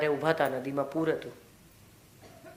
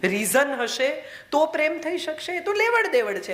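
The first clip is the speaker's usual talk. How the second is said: pitched up high, pressing the point; emphatic rise; 9 semitones higher than usual. Real, not acted. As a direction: push it up in disbelief. Rising emphatic argument.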